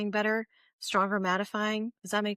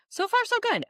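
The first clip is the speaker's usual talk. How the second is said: excited; 4 dB louder than usual. Real, not acted. Cheerful verdict on product.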